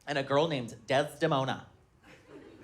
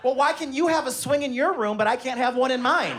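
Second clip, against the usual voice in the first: put-on higher curious child voice, rising to a squeal; imitating his daughter; 8 semitones higher than usual. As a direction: pester dad with innocent questions. Daughter's questions voiced in story.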